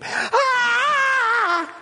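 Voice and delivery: a sudden high shriek of shock, "Ah!"; retold scream. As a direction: shriek like a startled mother in the pews. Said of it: "let out a scream".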